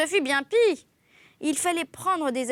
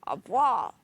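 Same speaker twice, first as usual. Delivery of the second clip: disgusted. A narrator's reading. Disgust at crushed vermin.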